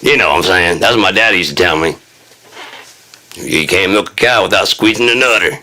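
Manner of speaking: normal talking voice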